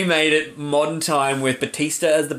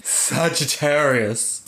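flamboyant; much slower than usual. Acted. Flamboyant read of the sign.

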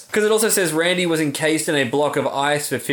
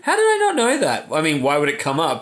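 ordinary delivery

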